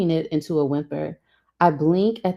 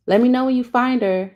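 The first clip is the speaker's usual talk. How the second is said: raised, higher parting call; 8 semitones higher, 4 dB louder than usual. A narrator's reading. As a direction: call after someone leaving, pitched up. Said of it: "she calls".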